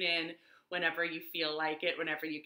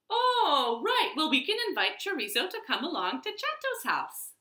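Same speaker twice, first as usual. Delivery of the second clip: high, fast, bright-eyed remembering; suddenly remember and rush the idea out; excited realization; 8 semitones higher than usual. Acted. Mouse to family, remembering their friend, high and quick.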